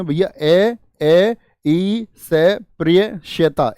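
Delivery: ए, ई, से, प्रिय slowly spelled out; each sound of अस्पृश्यता spelled apart; spelled out syllable by syllable. Shown as a demonstration. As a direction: break अस्पृश्यता into its letters, slowly. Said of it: "तो आप कहेंगे".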